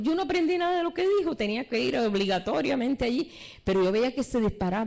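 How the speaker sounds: ordinary talk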